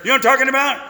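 ordinary talk

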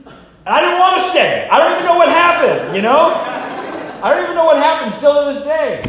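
high, loud amazed outburst; loud wonder at the mystery; incredulous exclaim. Real, not acted. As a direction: still can't believe it happened. Marvelling at his change.

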